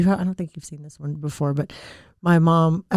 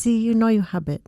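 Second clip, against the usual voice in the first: put-on higher Thai-accented mother's retort; Thai mom impression; 5 semitones higher than usual. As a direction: imitate a Thai mom having the last word. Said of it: "And she goes".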